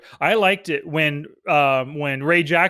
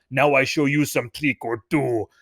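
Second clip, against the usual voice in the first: imitating Hossein; 5 semitones lower than usual. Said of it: "Hossein goes".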